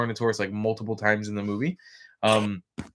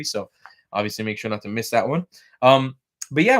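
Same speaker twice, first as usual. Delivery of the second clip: ordinary talk, nothing marked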